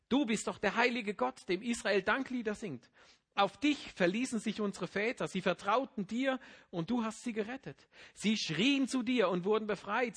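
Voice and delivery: loud, high, pleading recitation of the lament; impassioned psalm reading. Acted. Preacher reading Psalm 22 to the congregation, loud and pleading. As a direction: cry the psalm out to God, then let it sink. Reads Psalm 22 aloud with feeling.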